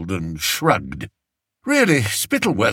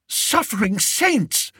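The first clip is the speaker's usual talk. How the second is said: high, slow horrified cry; 12 semitones higher than usual. A narrator's reading. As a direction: gasp it out in horror, high and slow.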